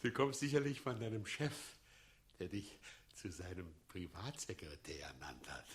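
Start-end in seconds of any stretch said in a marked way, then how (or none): 2.4-5.7 soft secretive whisper; whispered aside. Acted. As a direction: let it slip as a conspiratorial whisper. Mad mayor whispers aside.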